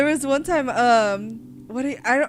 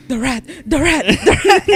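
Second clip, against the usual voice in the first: half-asleep panic; 3 semitones higher, 9 dB louder than usual. Retold or imitated. Brother in the car, jolting awake with mock rat alarm. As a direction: blurt it out like waking from a nightmare. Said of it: "he's like".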